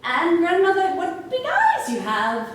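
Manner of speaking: high, loud amazed start; girl's wonder. Acted. Girl peers at grandmother in bed, loud high wonder. Girl marvels at disguised wolf.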